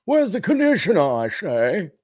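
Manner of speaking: high, affected snob voice; mock-pompous rich man. Man asking girlfriend for conditioner, mock-pompous voice. Carries Howell voice into retold question.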